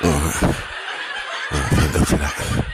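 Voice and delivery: hushed whisper of relief. Acted. Hiding from four-year-olds, whispering relief. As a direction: breathe it out in hiding.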